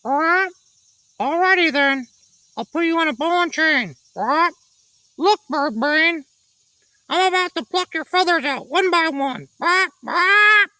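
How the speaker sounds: high squawking parrot voice; a cocky parrot making threats; screeching parrot. A narrator's reading. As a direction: taunt in a shrill bird voice. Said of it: "The parrot screeched".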